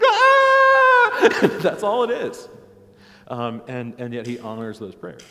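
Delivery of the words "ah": long, very high, loud mock wail; imitated inarticulate scream. Our prayers as God hears them, imitated child's scream. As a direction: cry out like a wordless child. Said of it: "even as articulate as they might be, sound like".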